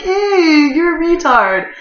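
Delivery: high, loud insult; angry retort. Retold or imitated. Insulting the cops fiddling with the engine, high and loud. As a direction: yell it at the clueless cops. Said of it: "I'm like".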